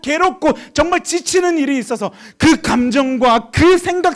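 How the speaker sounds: high, loud sermon crescendo; insistent, full-force pulpit appeal; impassioned preaching. Real, not acted. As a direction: drive each phrase at the congregation.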